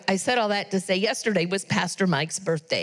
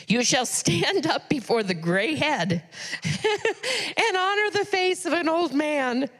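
reading through laughter, pitch climbing much higher, slow; laughing while reading; 7 semitones higher, slower than usual. Real, not acted. Reads verse about gray heads, laughing.

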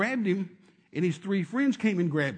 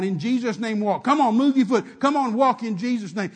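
loud, higher insistent urging; 4 semitones higher, 8 dB louder than usual. Retold or imitated. Preacher in the man's face urging him, loud. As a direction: press him hard to move. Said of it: "I just kept on".

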